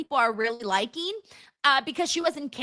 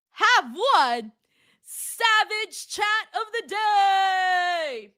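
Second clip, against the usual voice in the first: slow, stretched-out announcer shout; award announcer; 6 semitones higher, much slower than usual. Acted. Streamer crowning the chat winner, hammed-up announcer call. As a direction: boom it like a ring announcer.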